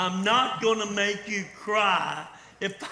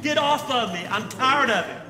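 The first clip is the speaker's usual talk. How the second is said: fed-up outburst; 5 semitones higher, 5 dB louder than usual. Acted. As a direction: push them off, done with the nagging. Walt snaps at nagging friends.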